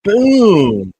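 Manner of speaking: sudden high, loud shout of boom. Real, not acted. Host shouting at a hard foul on the replay, loud shout. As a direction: yell it as the hit lands.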